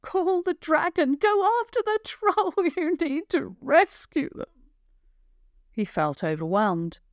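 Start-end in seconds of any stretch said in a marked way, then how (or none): 0.0-4.5 frantic shouting villager. Acted. Villagers crowd Javen demanding he chase the troll, high frantic shouts. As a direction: yell it up high like a panicked crowd. Crowd urging him at once.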